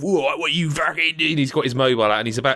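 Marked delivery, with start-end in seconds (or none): 0.0-1.4 put-on big thug's English accent, higher; hammed-up English hard-man challenge; mock English heavy. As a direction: play a caricature English gangster. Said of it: "there's a big sort of".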